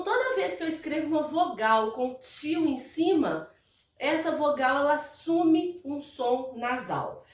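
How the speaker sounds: ordinary delivery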